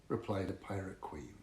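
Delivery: ordinary delivery, nothing marked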